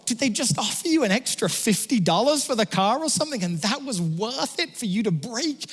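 high-pitched mock outrage over a broken deal; incredulous. Buyer ditched on Marketplace, high mock-indignant protest. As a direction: squeal it like a jilted buyer. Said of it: "And you're like".

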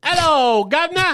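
high, loud mock Cockney greeting; Cockney accent. Comic showing British accents, high loud Cockney call. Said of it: "they do the Cockney".

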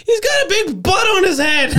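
high, loud cry through laughter; imitated laughing outburst. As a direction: crack up as you blurt it, mimicking an amused buddy. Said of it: "he's laughing".

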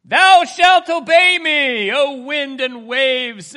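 grand King James rebuke. Retold or imitated. Preacher mocking a stately Jesus, slow booming decree. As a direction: boom it out like an old-fashioned proclamation. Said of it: "was not Jesus going".

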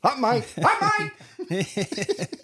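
high laughing pun yell; excited shout. Real, not acted. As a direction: belt the pun out gleefully.

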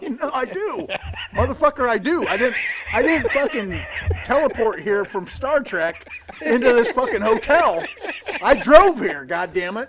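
loud, high, laughing yell; hammed-up furious rant; retold angry argument. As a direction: shout back at the worker, half laughing. Retold argument with hotel worker.

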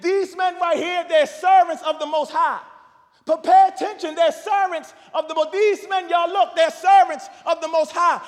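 loud, high calling after them; shouting slave girl. Retold or imitated. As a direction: cry it out over and over.

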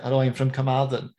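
put-on Carmarthen accent; Welsh accent. Comedian telling a joke about growing up in Carmarthen, imitated Welsh accent. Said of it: "a Carmarthen accent".

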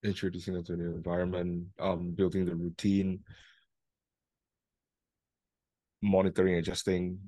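drowsy, half-asleep voice; sleepy and jet-lagged; nodding off. Real, not acted. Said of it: "I'm really half asleep".

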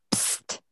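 soft, sharp psst; close, unvoiced attention-getter; imitated psst. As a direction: hiss psst as if right at an ear. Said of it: "like their lips were right at my ear".

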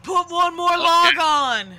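hammed-up imitation of a buddy; mock friend voice. Mocking friend at campfire.

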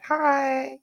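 sugary polite greeting; high-pitched sweet voice. Retold or imitated. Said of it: "When I get my really high-pitched voice and I smile".

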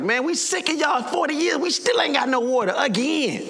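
indignant, high-pitched grumbling; mad. Acted. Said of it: "Like, they're mad".

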